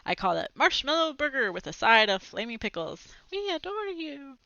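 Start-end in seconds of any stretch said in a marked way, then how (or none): 3.3-4.4 high, slow, soft swooning voice; adoring girls. Acted. Imagined girls swooning over the winning chef. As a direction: coo it like an adoring fan.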